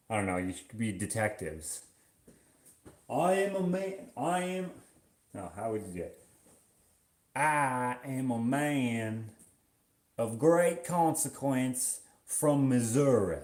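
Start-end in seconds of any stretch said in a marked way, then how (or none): 3.1-4.7 high-pitched mock Missouri accent; Missouri accent attempt. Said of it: "is that a Missouri accent?". 7.3-13.4 very slow, drawling mock Missouri gentleman; Missouri accent. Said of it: "is that a Missouri accent?".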